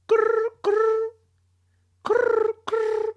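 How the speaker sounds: high buzzing growl like a vibrating phone; imitated phone buzz. Narrator imitating the ringing phone, buzzing growl. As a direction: mimic the phone rattling on the table.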